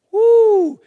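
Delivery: high, loud woo; excited whoop. Real, not acted. Preacher whooping after picturing Jesus's question. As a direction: whoop at the thought.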